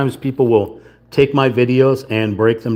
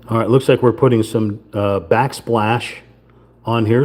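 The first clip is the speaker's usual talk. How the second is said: usual speaking voice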